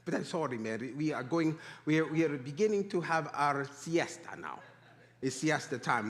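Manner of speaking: put-on a half-hearted try at a Spanish accent; attempted Spanish accent. Hotel clerk telling the American guests it's siesta, hint of Spanish accent. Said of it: "Oh, I, I can't do the accent".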